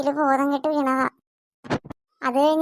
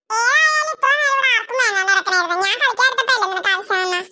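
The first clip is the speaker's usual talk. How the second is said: put-on whiny tearful child; 6 semitones higher, faster than usual. Child quarrel, starting to cry.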